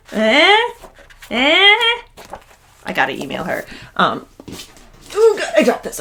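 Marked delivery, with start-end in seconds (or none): 0.1-0.7 higher and louder teasing eh; playful eh. Real, not acted. Showing off matching birth flowers. 1.3-2.0 much higher, louder eh, pushed further; bigger playful eh. Real, not acted. Repeated, even more delighted. 5.2-6.0 much higher, louder gush; overwhelmed with delight; delighted squeal. Real, not acted. Thrilled at her gift.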